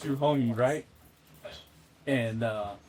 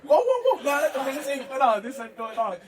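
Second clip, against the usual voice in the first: alarmed protest; 11 semitones higher than usual. Retold or imitated. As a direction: jump in fast to stop it. Dan Rue stopping the number swap.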